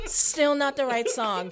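usual speaking voice

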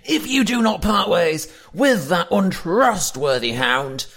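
high, loud furious shout; angry yelling. Acted. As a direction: yell it like a final warning. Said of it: "you hear someone yelling".

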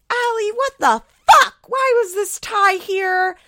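high, loud imitated mom yelling; mad. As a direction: act out an exasperated curse at full volume. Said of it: "Like, so fucking mad".

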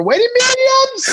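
high laughing yell; laughing shout. Retold or imitated. Comic ranting about schlepping merch, laughing shout. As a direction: yell it through laughter at the absurdity.

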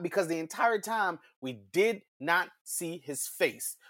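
usual speaking voice

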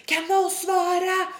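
high, slow drawn-out demand; mock screaming. Ignored customer to silent staff, mock screaming. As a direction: imitate a furious customer yelling. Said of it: "bara står och skriker".

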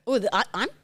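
ordinary delivery

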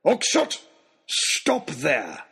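high bellowed order to halt; shouted command. A narrator's reading. Lord Daunt halts the figure at the gate, shouting. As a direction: shout to stop someone running off. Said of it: "At the sound of the shout".

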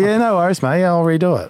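high, cheery forced politeness; aussie tradesman. Retold or imitated. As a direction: fake a cheerful no-worries to hide annoyance. Barista forcing cheer, retold.

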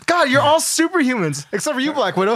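acted exasperation. Okoye complaining to her superhuman teammates, mock exasperation. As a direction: protest in hammed-up exasperation.

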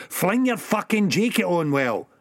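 ऊँचे सुर में हुक्म; जल्दी करो, ऊँची आवाज़ में; Sick Boy ordering. Acted.

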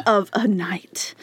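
slow, laughing whisper of the punchline; a giggly stage whisper; whispered echo. Real, not acted. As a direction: breathe the words back as a joke. Echoing a pun with a laugh.

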